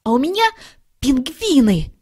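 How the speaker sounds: proud of his favourite; excited exclaim. A narrator's reading. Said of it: "воскликнул Шмяк". (восторженно, выше и громче)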